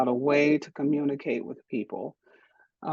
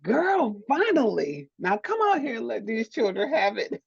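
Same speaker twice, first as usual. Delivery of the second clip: sassy friend; 8 semitones higher than usual. Retold or imitated. Friend's post cheering her coming out, sassy cheer. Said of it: "She was like".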